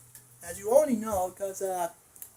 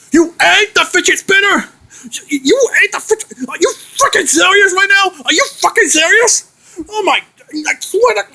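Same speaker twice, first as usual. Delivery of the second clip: angry yelling; 7 semitones higher than usual. Acted. Outraged at eating fidget spinner.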